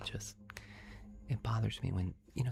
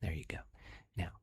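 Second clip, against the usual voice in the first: put-on southern accent. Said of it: "my southern accent".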